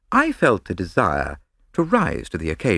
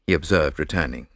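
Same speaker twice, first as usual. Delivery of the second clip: ordinary delivery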